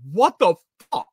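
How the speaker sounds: sudden high, loud startled cry; shocked outburst. Retold or imitated. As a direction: blurt it out in disbelief. Shocked outburst at dog spinning.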